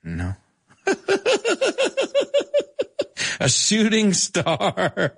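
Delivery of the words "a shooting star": slow, laughing repeat. Real, not acted. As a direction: giggle the words out.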